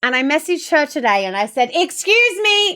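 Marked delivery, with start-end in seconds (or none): 1.7-2.8 high, loud mock-outraged "excuse me"; mock indignation. Streamer reads out her message to best friend, mock indignant. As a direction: demand attention in playful outrage.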